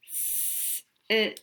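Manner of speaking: ordinary delivery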